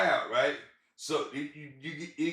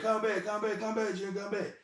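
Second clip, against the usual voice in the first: high pleading call fading softer; dramatic mama plea; 8 semitones higher, 4 dB quieter than usual. Retold or imitated. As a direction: beg him to come back, overdone. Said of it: "her being dramatic".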